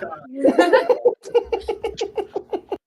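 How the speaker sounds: long high-pitched laugh; loud helpless giggling fit; laughter. Real, not acted. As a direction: burst into high, sustained laughter. Laughing after the teasing.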